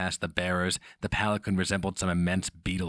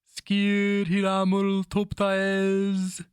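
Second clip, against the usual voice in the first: high, loud and very slow, an intoned name; much higher, louder, slow chanted sorcerer's invocation; sorcerer's incantation; 13 semitones higher, 6 dB louder, much slower than usual. Acted. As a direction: call the name out like a spell. Sorcerer speaks a name of power.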